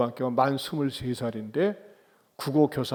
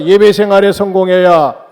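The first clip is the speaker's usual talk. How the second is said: loud, raised chant-like call; lead for repetition; 4 semitones higher, 13 dB louder than usual. Shown as a demonstration. Preacher has the congregation repeat after him, loud leading call. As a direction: proclaim it for everyone to echo. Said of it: "따라서 합시다".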